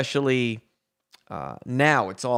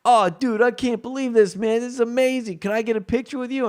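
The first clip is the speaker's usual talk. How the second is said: higher, thrilled fan voice; a high fan gushes over a photo, higher; excited stoner fan; 8 semitones higher than usual. Retold or imitated. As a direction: gush like a starstruck stoner. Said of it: "he comes up to me and he goes".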